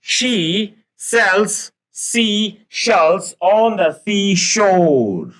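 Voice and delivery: very slow, high, every sh drawn out; slow tongue twister. Shown as a demonstration. Teacher slowly demonstrating the tongue twister, high and drawn out. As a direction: model "she sells seashells" word by word for the class. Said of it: "तो आप बार बार बोलेंगे".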